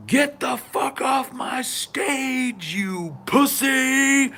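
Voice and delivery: high, slow drawn-out taunting yell. Retold or imitated. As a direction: stretch each word like a rock star on stage. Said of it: "T J yells".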